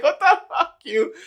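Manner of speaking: high, loud disbelief through laughter; laughing mock outrage. Retelling his mate's shocked reply, laughing high and loud. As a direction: squeal it out laughing. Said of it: "So he was like".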